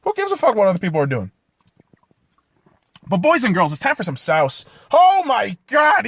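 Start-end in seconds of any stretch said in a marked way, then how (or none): 4.9-6.1 slow, loud, high fake gasp of shock; mock amazement. Real, not acted. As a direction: ham up being stunned.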